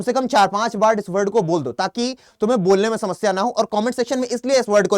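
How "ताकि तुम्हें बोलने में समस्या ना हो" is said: usual speaking voice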